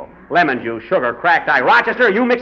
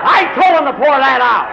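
exasperated shout; 6 semitones higher, 4 dB louder than usual. Acted. Shouting across the field.

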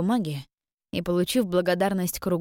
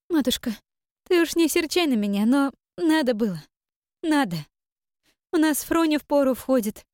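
high, brave put-on brightness; forced cheerfulness; 9 semitones higher, 3 dB louder than usual. A narrator's reading. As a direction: keep it bright over the hurt. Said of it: "Таша наигранно бодро встала".

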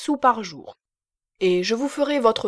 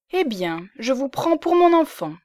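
gaily; 5 semitones higher than usual. Acted. Lisbeth gaily adopting the young man she rescued, cheerful and higher. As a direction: gladly claim him as her own child. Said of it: "dit-elle gaiement".

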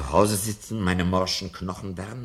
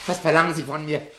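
frantic question; 9 semitones higher, 7 dB louder, faster than usual. Acted. Professor gives in, frantic.

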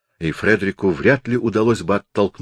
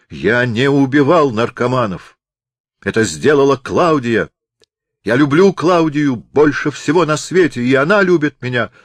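impassioned confession; 5 semitones higher, 4 dB louder than usual. Acted. Doctor confesses in rising passion.

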